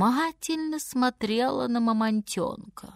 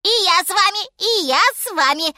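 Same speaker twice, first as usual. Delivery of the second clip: high, loud monkey squeal; shrieking little monkey; 10 semitones higher, 6 dB louder, faster than usual. A narrator's reading. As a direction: call out excitedly in a high monkey voice. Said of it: "закричала обезьянка".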